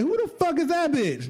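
high-pitched imitation of an indignant girlfriend; mock jealous outrage. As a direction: act out her suspicious demand, high and comic. Girlfriend's suspicion acted out.

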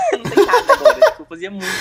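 laughing through the words, high and loud. Real, not acted. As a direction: giggle out the admission. Laughing at himself.

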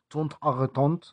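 "Tont a retont" in fake French; frenchify "Tont a retont"; a touch of put-on French; mock French. Shown as a demonstration. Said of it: "como le dicen en Marsella".

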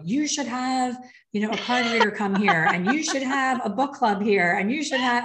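high, loud self-mocking gush of advice; over-involved. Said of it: "I get too over involved".

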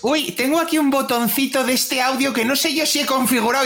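high, mocking imitation of a fussy techie. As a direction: squeak it out like a clueless techie. Mocking friend fiddling with audio. (imitación burlona aguda)

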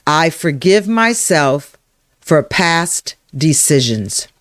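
slow affirmation with spaced phrases; spoken affirmation. Shown as a demonstration. Coach leading listeners to say it aloud, slow and deliberate. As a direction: say it slowly and deliberately. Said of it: "I want you to say it again".

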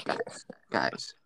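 hushed whisper of guys, guys. Real, not acted. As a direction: whisper it to get their attention. Hushing friends to get attention.

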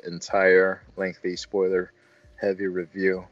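normal talking voice

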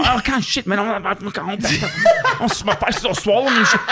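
high, whining mock of a friend, laughing by the end; mocking whiny friend. Teasing a co-host about his travel troubles, high whining mockery. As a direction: pitch it up and whine like he's miserable. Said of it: "you came in the room going".

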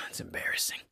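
unvoiced, very soft whisper. Podcaster stewing over mother's text, whispered. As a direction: whisper it as if to yourself. Earlier complaint repeated in whisper.

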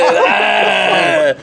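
loud, high-pitched "ah"; imitated excited yell. Storyteller imitating his buddy's cheer, loud high yell. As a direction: cry out high in mock excitement. Friend's outburst on phone acted out.

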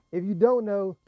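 normal talking voice

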